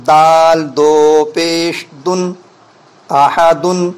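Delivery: slow, deliberate letter-by-letter spelling; slow, measured teacher's recitation; careful correct spelling. Shown as a demonstration. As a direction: spell each letter out slowly and clearly for the children. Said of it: "جو صحیح طریقے پر ہیجے کرانی چاہیے اس کو غور سے سنیں".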